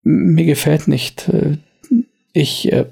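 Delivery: stammelnd, etwas höher; er windet sich stotternd heraus; verängstigter Wirt. Acted. Wirt wird bedroht. (stotternder Wirt, etwas höher)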